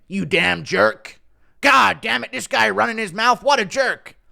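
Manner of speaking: mildly hammed-up name-calling, rising pitch; mock indignation. Showing critics how to insult him, mock annoyed. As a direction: act out a critic griping about a jerk. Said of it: "call me a jerk".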